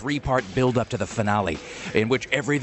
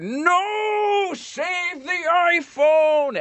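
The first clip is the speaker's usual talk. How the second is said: high, long-stretched alarm, slow and drawn out; hammed-up slow-motion no, save the phone; mock panicked cry; much slower than usual. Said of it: "I said".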